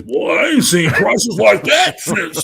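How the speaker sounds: a hint of a grumbling old-timer; very old man. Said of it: "let me put on my old man voice".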